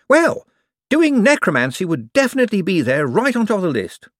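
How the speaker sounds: high, eager, bright-voiced wizard; a jumpy official covering for himself. A narrator's reading. Hicks defining evil wizards.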